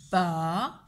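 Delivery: "Baleine" in three long separate syllables, ba-lei-ne. Shown as a demonstration. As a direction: dictate "Baleine" very slowly, holding L and N. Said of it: "mot de trois syllabes". (« Baleine » dicté lentement en trois syllabes)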